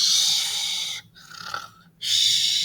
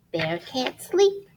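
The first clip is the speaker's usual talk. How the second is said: ordinary delivery, nothing marked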